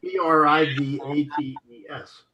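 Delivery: each letter of P R I V A T E S said separately and evenly; spelled out letter by letter. Shown as a demonstration. Reading the word letter by letter to check the spelling for the co-host. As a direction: spell out P R I V A T E S one letter at a time. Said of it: "it has to be privates".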